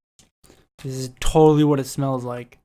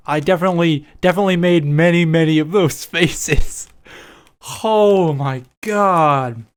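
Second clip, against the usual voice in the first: laughing loudly; 2 semitones higher, 7 dB louder than usual. Real, not acted. Loud laughing reaction.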